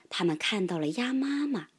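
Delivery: ordinary delivery, nothing marked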